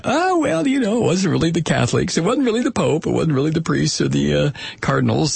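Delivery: high, whiny mock apologist voice. Mocking apologists' excuse.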